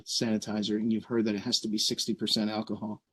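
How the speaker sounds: ordinary talk, nothing marked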